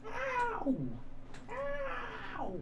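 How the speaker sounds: high sudden yelp of pain. Real, not acted. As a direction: squeal as the pup bites. Bitten by puppy.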